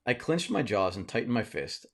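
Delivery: ordinary delivery, nothing marked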